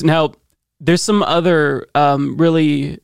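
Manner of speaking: usual speaking voice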